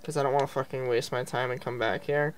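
normal talking voice

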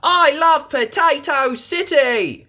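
very high, loud joyful shout; Peppa shouting. A narrator's reading. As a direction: belt it out in delight. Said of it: "shouts Peppa".

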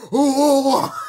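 loud high "Oh!" battle cry; big imitated attack yell; imitated wrestler roar. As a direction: bellow like a wrestler charging in. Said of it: "and attack K O and go like".

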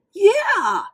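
high loud happy yeah; excited yeah. Acted. Mater confirming his gift to Lightning, thrilled. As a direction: burst out with delight.

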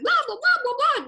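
high, loud mock chatter; gushing chatter. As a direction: babble on eagerly. Mimicking her own gushing chatter.

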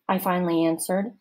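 ordinary delivery, nothing marked

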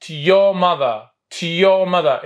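very slow, stretched phrase; slow model reading. Shown as a demonstration. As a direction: drag the sentence out for repetition. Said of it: "خلينا نعيدها مرة ثانية".

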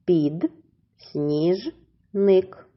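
"Під, сніж, ник" in three separate beats; split into syllables. Shown as a demonstration. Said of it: "Ділимо на склади".

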